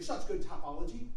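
ordinary talk, nothing marked

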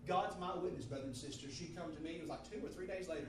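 normal talking voice

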